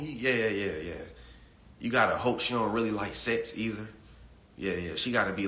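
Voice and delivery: usual speaking voice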